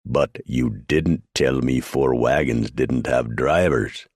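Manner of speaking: slow, every word jabbed; emphasizing each word. A narrator's reading. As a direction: hammer each word home. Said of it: "poked a thick finger to emphasize each word".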